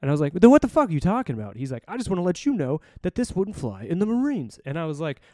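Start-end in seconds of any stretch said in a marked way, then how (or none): 0.3-1.6 louder, higher, fast exasperated comeback; indignant retort. Retold or imitated. Recruiter challenging an angry dad, indignant. Acted argument with an angry stranger. 1.9-4.6 higher-pitched imitation of a preachy complainer; mock self-righteous lecturing. Angry dad lecturing a Navy recruiter, mock self-righteous.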